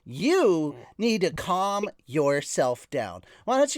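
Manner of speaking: high, sassy scolding. Pretend. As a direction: tell them off with attitude. Scolds co-host for interrupting.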